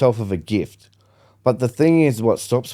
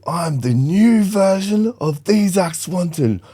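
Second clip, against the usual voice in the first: higher, slow, defiant announcement; bold declaration; 8 semitones higher, slower than usual. Retold or imitated. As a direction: proclaim it to the camera like a champion. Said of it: "And I said".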